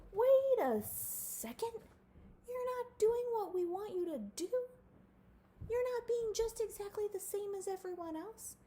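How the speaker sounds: hammed-up complaining crowd; mock neighbors. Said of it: "other people were like".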